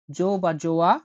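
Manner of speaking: ordinary talk, nothing marked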